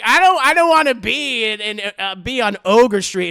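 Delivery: loud, anxious protest; scared refusal. Acted. Coachman refusing JoJo's destination, loud protest. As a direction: refuse loudly in fear.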